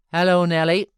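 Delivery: a loud, calling hello; loud greeting. A narrator's reading. Heathcliff greets Nelly at the door, calling out loudly. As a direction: hail her from across the room.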